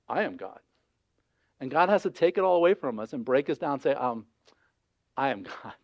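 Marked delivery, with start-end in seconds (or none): 0.1-0.6 low, soft claim of control; subdued, deep declaration; quiet self-declaration. Acted. People claiming control, quietly voiced. 5.2-5.7 low, soft, settled declaration; hushed, deep statement of authority; calm divine authority. Acted. God correcting us, quietly.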